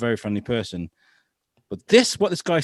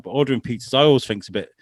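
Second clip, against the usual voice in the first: ordinary talk, nothing marked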